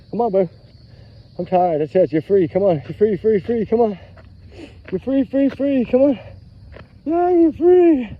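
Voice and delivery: urging on. Real, not acted. Owner telling bro "you're free, come on", urging.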